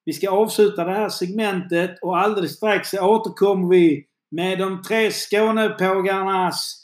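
upbeat, lifted presenter voice; radio host sign-off. Acted. Host sending listeners to the break, upbeat announcer sign-off. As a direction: punch up the segment outro, rising to a big finish.

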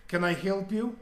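put-on straight-faced line in a Russian accent; deadpan Russian accent. Russian girl to the intruding boss, deadpan Russian accent. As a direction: imitate a deadpan Russian accent, pitched a little up. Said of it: "Russian accent".

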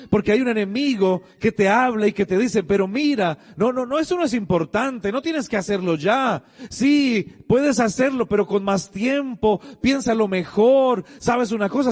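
high-pitched, driving sermon delivery; urgent preaching. Real, not acted. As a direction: press the congregation to act today. Preaches urgently at full force.

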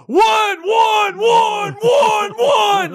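high-pitched screamed chant, mimicking a coach; screaming coach. Coach calling for the one-point try, screaming. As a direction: scream it like an over-the-top coach. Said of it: "the coach go screaming".

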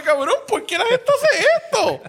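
high, laughing, disbelieving question; high-pitched laughing "why do people do this"; incredulous. Retold or imitated. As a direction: squeal it, baffled by people. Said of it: "Y yo me quedé como de".

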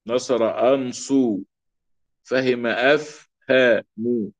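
أفهم said slowly, syllable by syllable; slow model reading. Shown as a demonstration. Grammar teacher modelling verb forms slowly for students. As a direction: spell out أفهم slowly for the class. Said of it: "فتح الأول وتسكين الثاني".

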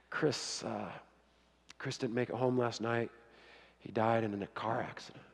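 hushed grave news. Retold or imitated. Father breaks news of death.

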